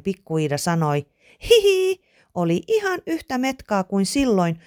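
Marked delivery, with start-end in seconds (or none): 1.5-4.6 high squeaky giggle easing into speech; high, amused child's voice; giggly little girl. A narrator's reading. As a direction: start on a very high hee-hee, then settle down. Little Iida giggles as she recalls.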